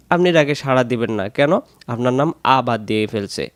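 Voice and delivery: ordinary delivery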